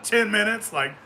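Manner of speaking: normal talking voice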